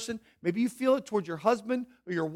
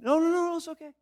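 higher, hurried brush-off; insistent denial; 7 semitones higher, faster than usual. Retold or imitated. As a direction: wave the concern away, a touch higher. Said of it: "People say".

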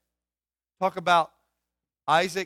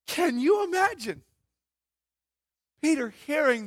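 amazed; 9 semitones higher, 4 dB louder than usual. Real, not acted. Amazed exclamation.

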